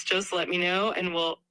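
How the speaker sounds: ordinary talk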